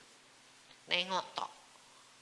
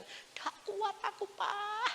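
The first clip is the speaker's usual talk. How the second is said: very high, drawn-out wail of pain; suffering cry; slower than usual. Retold or imitated. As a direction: imitate agonised complaining in a high voice. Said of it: "jam dua dia bilang". (rintihan kesakitan bernada tinggi)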